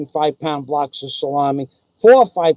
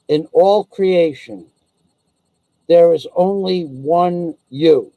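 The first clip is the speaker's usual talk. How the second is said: slow solemn; slower than usual. Shown as a demonstration. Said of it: "repeated saying, slower".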